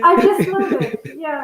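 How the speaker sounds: laughing through the words. Real, not acted. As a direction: chuckle as you say you love it. Laughing while praising Scottish accents.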